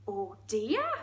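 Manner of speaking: dismayed Geordie lilt, rising at the end; Geordie hippo. Acted. Hippo hearing of secret plans, dismayed Geordie. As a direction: react with Geordie concern. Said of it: "It's really Geordie".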